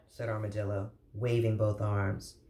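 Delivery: ordinary delivery, nothing marked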